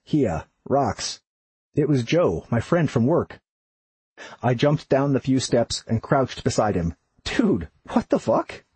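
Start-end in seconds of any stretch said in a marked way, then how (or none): 0.0-1.2 lightly voiced Boston accent; a hint of a Boston greeting. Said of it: "the strong Boston accent". 7.2-8.7 stunned disbelief. Acted. Shocked at friend's surprise visit.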